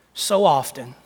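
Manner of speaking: usual speaking voice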